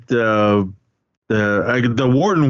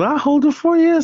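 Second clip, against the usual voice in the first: mimicking Wallace. Wallace offers mirror, retold scene.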